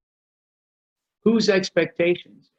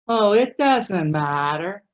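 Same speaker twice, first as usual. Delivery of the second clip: slow, drawn-out put-on indifference; mock easygoing; 3 semitones higher, slower than usual. Spouse answering about dinner, feigning indifference. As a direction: stretch it out as if you don't care. Acted couple choosing where to eat.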